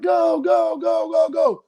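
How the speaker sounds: high, drawn-out urging calls; excited cheering. Retold or imitated. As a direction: cheer on runners racing for the ship. Cheering on pier runners.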